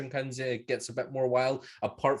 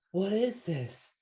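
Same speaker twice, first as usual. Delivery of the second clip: puzzled guests; 6 semitones higher, 3 dB quieter, slower than usual. Retold or imitated. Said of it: "and they're like".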